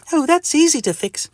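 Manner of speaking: very high lady doctor voice; high and matter-of-fact; Missus Doctor. Acted. Doctor reassures the itchy patient, high put-on voice. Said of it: "says Missus Doctor".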